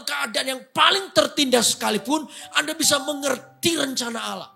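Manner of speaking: loud, high, driving sermon; full-force sermon climax; fiery preaching. Real, not acted. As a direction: preach it to the whole congregation.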